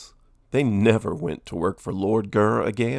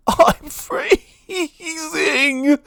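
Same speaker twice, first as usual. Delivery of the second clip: high, shivering drawn-out cry; high, stretched-out freezing howl; freezing wail; much slower than usual. Acted. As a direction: wail it slowly through chattering shivers. Villain buried in ice cream.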